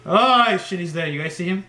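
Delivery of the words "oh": high loud shriek; startled scream. Real, not acted. Gamer jump-scared by the clown: high shriek. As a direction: scream in fright.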